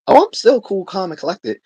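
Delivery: a jokey, overblown defence of his cool; mock indignant protest. Real, not acted. Answering a chatter who says he's not calm, high and loud mock protest.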